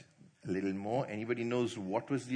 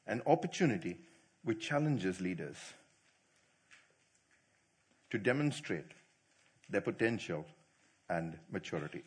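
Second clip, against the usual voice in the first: slow dictation; 6 semitones lower than usual. Shown as a demonstration. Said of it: "A definition for testing".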